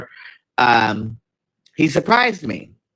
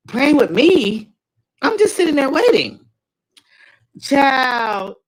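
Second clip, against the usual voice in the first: indignant; 11 semitones higher, 4 dB louder, slower than usual. Real, not acted. Indignant retort to listener.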